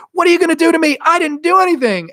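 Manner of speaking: high-pitched, loud defensive protest; acted protest. Tattling girl protesting to the killer, mock high-pitched panic.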